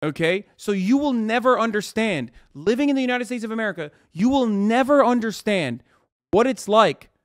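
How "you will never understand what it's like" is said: ordinary talk, nothing marked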